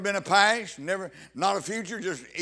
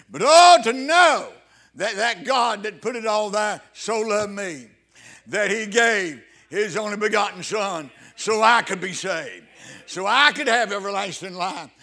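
ecstatic preaching; 6 dB louder than usual. Acted. Preacher marveling aloud to the congregation, rising loud cry. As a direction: cry it out in joyful wonder.

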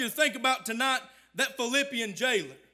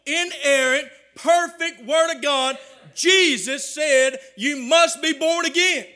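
full-force preaching; 2 semitones higher, 5 dB louder than usual. Real, not acted. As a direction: drive each phrase harder until you shout it.

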